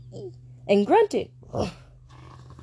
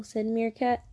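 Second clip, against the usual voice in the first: ordinary delivery, nothing marked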